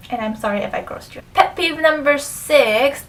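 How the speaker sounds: usual speaking voice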